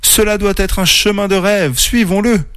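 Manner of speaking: high, eager wonder; amazed. A narrator's reading. Oreille sees a path of light, amazed. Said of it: "Oreille était émerveillée".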